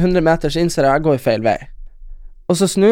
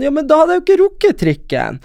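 much higher-pitched indignant objection; retold protest; 12 semitones higher than usual. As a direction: argue back in a high voice. Retold protest to tram conductor.

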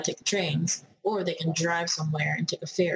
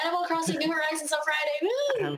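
high, fast, hyped-up cheer; excited; 11 semitones higher than usual. Real, not acted. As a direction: gush about it like a thrilled fan. Hyping a game release.

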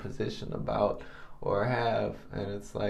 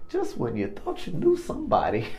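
mock crying; 13 semitones higher, 5 dB louder than usual. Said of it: "I cried".

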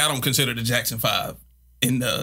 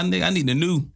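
ordinary talk

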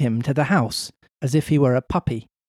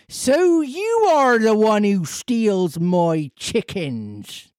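slow, higher Cornish farmer voice; angry farmer; 6 semitones higher, much slower than usual. Acted. Farmer accusing Pinocchio of stealing chickens, angry. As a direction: accuse the boy slowly. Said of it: "said the farmer in an angry voice".

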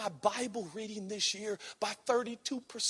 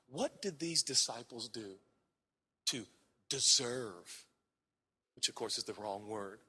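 ordinary delivery

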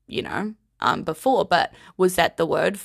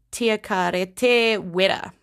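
slow careful demo; slower than usual. Shown as a demonstration. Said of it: "it's said very quickly".

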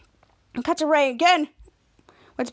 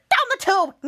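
mad; 8 semitones higher than usual. Retold or imitated. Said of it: "he was so mad".

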